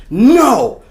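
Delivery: high, very loud banishing yell; emphatic shout, each refusal drawn out; acted defiant shouting. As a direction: order the spirit out at full voice. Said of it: "And I say this out loud".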